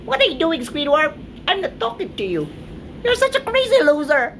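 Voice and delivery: very high, squeaky cartoon voice; high-pitched cartoon kid voice; SpongeBob-style squeak.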